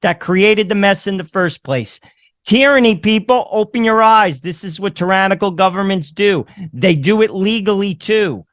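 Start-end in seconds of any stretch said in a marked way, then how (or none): 2.4-4.4 loud, high rallying shout; calling out. Real, not acted. Host urging viewers, a loud high rallying shout. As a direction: shout a warning at the audience. Ranting to viewers about government.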